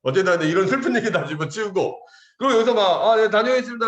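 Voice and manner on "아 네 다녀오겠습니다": loud, high mock recruit voice; recruit's farewell. New recruit leaving family, loud mock farewell. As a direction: call out an eager, green farewell.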